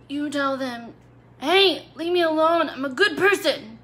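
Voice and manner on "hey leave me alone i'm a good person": higher and louder, standing your ground; firm demo. Shown as a demonstration. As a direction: say it boldly to the mean person. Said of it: "Just like that".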